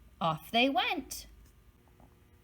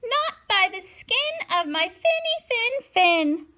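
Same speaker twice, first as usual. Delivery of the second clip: much higher, small but brave voice; brave little fish; 12 semitones higher, 3 dB louder than usual. Acted. Brave little fish defying the shark, high and small. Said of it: "the brave little fish replied".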